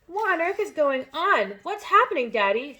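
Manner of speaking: alarmed high shout; cried out. A narrator's reading. Matilda confronts her father, alarmed high cry. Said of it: "she cried".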